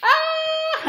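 high squeal into a laugh; pretend shriek and laugh. Storyteller laughs on cue at the silly rhyme. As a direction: act like it's the silliest thing ever. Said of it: "laugh like it is the silliest".

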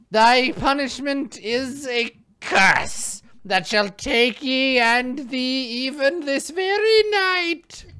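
high, slow cackling crone; witch voice. Acted. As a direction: screech it like an old hag. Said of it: "having a really good time with witch voice".

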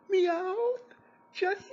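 high, loud whiny call; mocking nasal James. Reviewer mocks the actor calling out names, high whiny voice. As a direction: squeak the names out like a weak actor. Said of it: "James Carter Cathcart is like".

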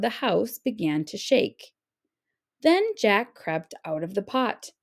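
usual speaking voice

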